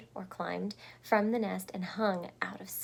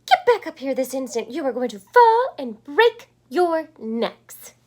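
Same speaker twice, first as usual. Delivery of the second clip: loud, fast angry scold; scolding mother; 4 semitones higher, 14 dB louder than usual. A narrator's reading. Mama Bird scolding her babies for hanging, loud angry. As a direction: scold them sharply. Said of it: "She's not happy".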